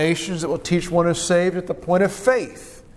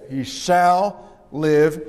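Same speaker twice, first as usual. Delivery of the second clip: drawn out, every word weighed; slow emphatic repeat; much slower than usual. Acted. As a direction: let "He shall live" sink in slowly. Preacher repeats the phrase slowly.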